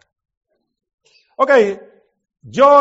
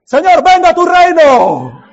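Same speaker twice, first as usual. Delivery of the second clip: fervent prayer; 7 semitones higher, 9 dB louder, slower than usual. Real, not acted. Preacher prays aloud mid-sermon.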